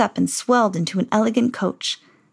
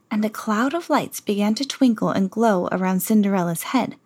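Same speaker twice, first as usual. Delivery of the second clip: usual speaking voice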